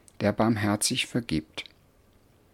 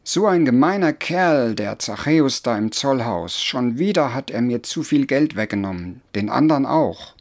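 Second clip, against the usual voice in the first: scolding men; 2 semitones higher, 4 dB louder than usual. Acted. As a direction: complain loudly about the cheat. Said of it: "Die Männer schimpften und schimpften".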